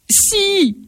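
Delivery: high sharp insistent yes. A narrator's reading. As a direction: snap back, insisting. Wife insists. (« Si ! » aigu et insistant)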